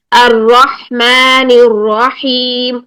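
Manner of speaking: الرحمن الرحیم slow, heavy ra; slow Quranic recitation; tajweed recitation. Shown as a demonstration. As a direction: recite slowly with a steady ra. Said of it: "یہ چیز".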